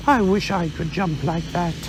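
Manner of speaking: Pooh, a little vague; mild bear voice, unhurried; lightly voiced Pooh bear. Pooh thinks aloud.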